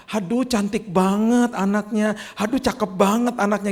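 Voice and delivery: high, slow gushing voice, mock admiring; gushing friends. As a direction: coo over the baby like an adoring aunt. Friends gushing over baby.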